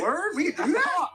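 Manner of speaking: very high, mock-astonished squeal; Trudeau delighted. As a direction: play him thrilled to discover a new power. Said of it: "Trudeau's gonna go".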